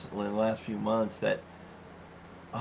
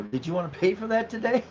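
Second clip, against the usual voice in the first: higher, overly polite check at the till; mock polite question; 10 semitones higher than usual. Employee to shoplifter, mock polite. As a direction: sweetly ask if they meant to pay. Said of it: "we are to politely just point out".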